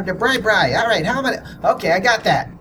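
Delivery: high cartoonish character voice; Pookie Chang. Podcaster refreshing a character before an episode, high voice. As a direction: slip back up into the high character voice. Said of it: "when he goes in the next episode".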